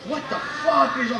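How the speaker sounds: ordinary talk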